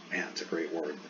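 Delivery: normal talking voice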